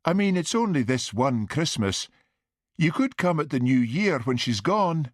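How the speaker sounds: high, lilting plea; pleading mother. A narrator's reading. Mother pleading with son to skip Christmas, pleading. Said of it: "pleaded his mother".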